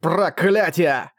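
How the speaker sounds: slow drawn-out yelled curse. A narrator's reading. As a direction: shout the curse out long. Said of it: "заорал принц". (крик)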